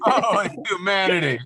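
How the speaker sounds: high, loud newsreel announcer; old-timey reporter. Acted. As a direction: cry it out like a 1930s newsman. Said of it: "old-timey reporter".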